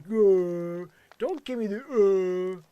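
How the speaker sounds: loud imitated "uh" groan; mocking groan. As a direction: throw their groan back at them, loudly. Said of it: "When you're like".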